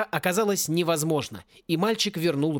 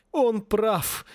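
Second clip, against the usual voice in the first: higher, distressed cry; nearly crying; 5 semitones higher than usual. A narrator's reading. Dean admitting defeat, nearly crying. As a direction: wail it as if close to tears. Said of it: "едва не плакал декан".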